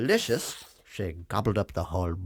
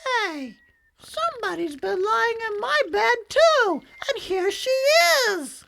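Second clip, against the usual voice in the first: very high, louder squeaky cub; excited little cub crying out; Baby Bear voice; 8 dB louder than usual. A narrator's reading. Baby Bear finds Goldilocks in his bed, very high squeaky cry.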